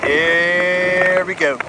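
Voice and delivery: very slow, drawn-out here we go. Shown as a demonstration. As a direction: stretch every word out long. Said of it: "repeated lines".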